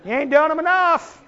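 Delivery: a lightly voiced wife calling out that it's not enough; nagging wife. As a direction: play the wife scolding him from the pews. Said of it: "She's probably going".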